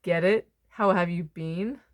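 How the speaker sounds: ordinary delivery, nothing marked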